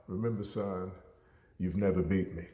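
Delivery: low, soft father's reply; father calmly reminding his son, low and soft; quiet fatherly reminder. Retold or imitated. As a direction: say it down low and soft like a proud dad. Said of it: "Dad was like".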